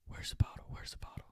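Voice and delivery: hushed, secretive retold whisper; furtive whisper. Friends in the back search for the bottle, furtive whisper. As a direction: whisper it so the police won't hear.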